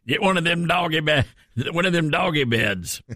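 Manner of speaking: playful hammed-up Southern twang; mock a down-home Southern pitch; Southern accent. Host joking about doggy beds, exaggerated Southern accent. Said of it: "I think it's your Southern accent".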